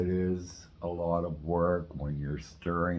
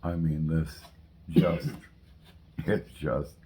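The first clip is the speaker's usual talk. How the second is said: talking with a mouthful; words round a bite of food; mouth full; 3 semitones lower, 6 dB quieter than usual. Real, not acted. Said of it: "I'm talking with my mouth full right now".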